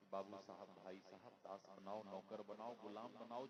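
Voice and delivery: low, soft mock begging; lightly acted captive begging for mercy; meek pleading. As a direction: plead humbly in an undertone. Captured man begging bandits for mercy.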